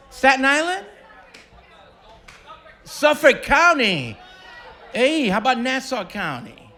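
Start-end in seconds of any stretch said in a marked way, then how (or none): none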